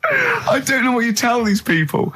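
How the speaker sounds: crying with laughter through the words; laughing. Real, not acted. Host laughing helplessly at his co-host's interview. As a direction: squeal it out mid-laugh.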